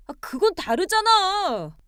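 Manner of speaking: high, loud, drawn-out objection; exasperated protest. Acted. Husband cornered by wife's argument, exasperated. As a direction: protest loudly, stretching it out high.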